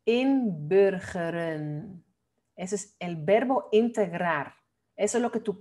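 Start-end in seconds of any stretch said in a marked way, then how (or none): 0.0-2.0 inburgeren, very slow, syllable by syllable, with a Dutch rounded vowel; model pronunciation. Shown as a demonstration. Teacher modelling "inburgeren" for learners, slow Dutch pronunciation. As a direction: sound out "inburgeren" slowly and carefully in Dutch. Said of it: "saber decir esta palabra bien".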